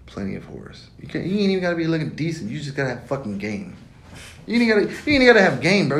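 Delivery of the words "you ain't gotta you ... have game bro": high, loud insistence; loud high-pitched insistence; emphatic shout. Real, not acted. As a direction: shout the correction. Emphatic correction.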